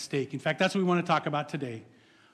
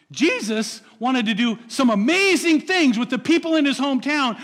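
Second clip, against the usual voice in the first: loud, raised preacher's appeal; fervent preaching; 5 semitones higher, 7 dB louder than usual. Acted. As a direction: drive the encouragement home to the congregation. Preaching at full force.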